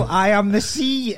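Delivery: grand proclamation. Retold or imitated. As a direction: boom it out like a self-declared sea god. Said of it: "he'd come on and go".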